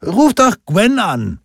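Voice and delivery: high, loud, drawn-out suggestion; voiced bar buddy. A narrator's reading. Lagarde suggests that Lindqvist phone Gwen, high-voiced character. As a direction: pitch it way up, slow and loud, as another man at the table.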